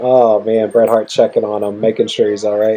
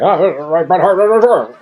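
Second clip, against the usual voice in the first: higher, louder mock Vince McMahon call; 8 semitones higher, 4 dB louder than usual. Retelling what Vince said, in a mock higher louder voice. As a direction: ham up the boss barking names. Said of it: "but he's like".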